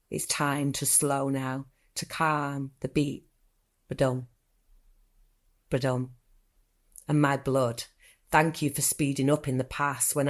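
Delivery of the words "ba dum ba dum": heartbeat sound. Pretend. Voicing a heartbeat in poem.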